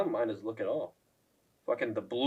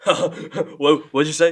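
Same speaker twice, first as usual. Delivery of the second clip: loud retort; 3 semitones higher, 12 dB louder, much slower than usual. Retold or imitated. Strickland's loud retort, retold.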